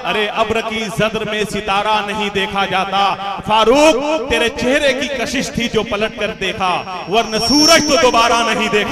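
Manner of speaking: declaimed Urdu couplet, slow and stately; poetry recitation. Acted. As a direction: recite it to a mushaira crowd. Reciting a sher at mushaira.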